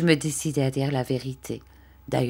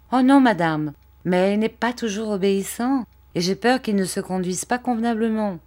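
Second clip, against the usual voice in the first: higher-pitched, lightly voiced boy; earnest boy answering; 6 semitones higher than usual. A narrator's reading. Young performer reassuring the lady about his monkey, earnest.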